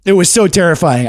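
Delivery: usual speaking voice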